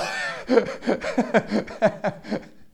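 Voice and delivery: loud laugh at his own joke; real amused laugh; hearty laugh. Real, not acted.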